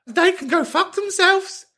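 very high squealed outrage; shrieky high register, playfully indignant; comic indignation. Acted. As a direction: squeal it in mock outrage for a friend. Indignant on caller's behalf.

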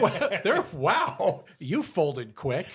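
hammed-up disbelief at a quick cave-in; mock amazement. Host mocking Elon's price drop, loud mock astonishment. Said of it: "I'm like".

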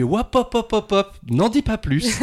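high-pitched, hurried cut-in to stop someone; playful high-voiced "stop right there"; retold interruption. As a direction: blurt it high to halt the story. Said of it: "Et j'ai fait".

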